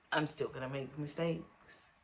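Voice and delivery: ordinary talk, nothing marked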